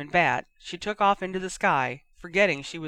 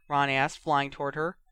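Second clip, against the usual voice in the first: ordinary delivery, nothing marked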